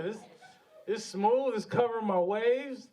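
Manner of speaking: ordinary delivery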